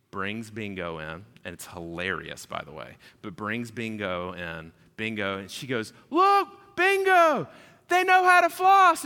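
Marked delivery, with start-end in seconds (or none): 6.1-9.0 very high, loud cartoon child voice; excited little kid. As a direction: squeal it like a thrilled little girl. Child shows sister grandparents floss.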